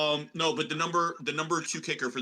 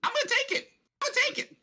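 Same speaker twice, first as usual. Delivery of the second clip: put-on hammy prospector accent, pitched up; Deuce Chiggins; 5 semitones higher than usual. Co-host reads his under bets to the panel, prospector voice. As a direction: play a high-voiced old-time prospector rattling off bets. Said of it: "I don't know what accent I'm doing".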